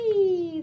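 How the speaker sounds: whee squeal. Acted. Animals sledding again.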